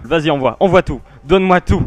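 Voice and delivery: eager. Real, not acted. Eager call to play the video.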